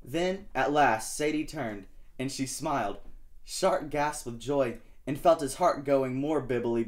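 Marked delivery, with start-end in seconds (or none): none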